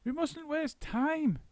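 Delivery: high, slow, urgent monkey; urgent cry. A narrator's reading. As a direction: cry out to hurry them. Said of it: "cried Mugglewump".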